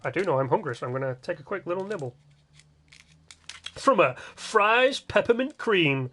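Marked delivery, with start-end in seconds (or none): 3.8-6.1 slow, raised-pitch ad read; savouring, drawn-out product pitch; mock advert. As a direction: sell the chocolate like a TV commercial. Mock advert for his snack.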